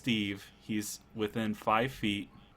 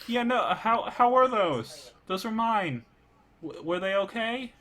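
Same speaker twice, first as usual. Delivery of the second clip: higher, hesitant worried voice; anxious baker skeleton; 10 semitones higher than usual. Acted. Skeleton baker asking if its cookies were okay, high nervous voice. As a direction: fret over the cookies.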